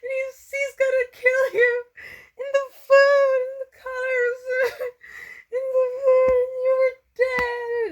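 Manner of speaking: half-laughing mock sob from a bad dream; hysterical sobbing. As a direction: sob it out, panicked from a nightmare. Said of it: "I was sobbing in hysterics".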